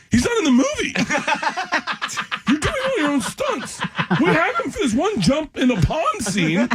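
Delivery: high, laughing quote of the director. Retold or imitated. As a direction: laugh through the director's line.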